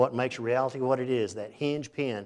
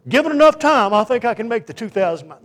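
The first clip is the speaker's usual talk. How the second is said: high, loud mocking voice of a proud man; prideful boaster; 9 semitones higher, 10 dB louder than usual. Preacher mocking the prideful who think they can swim it. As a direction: ham up a cocky know-it-all. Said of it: "prideful people say".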